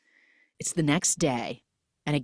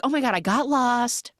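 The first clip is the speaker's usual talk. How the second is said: flustered; 9 semitones higher than usual. Retold or imitated. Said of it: "She's like".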